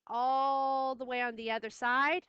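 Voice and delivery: long, loud stretch on "all"; drawn-out ALL. Shown as a demonstration. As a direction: draw "all" out as if reaching across. Teacher stretches "all" to show distance.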